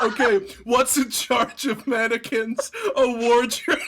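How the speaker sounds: laughing through a quote; giggling as you say it. Retold or imitated.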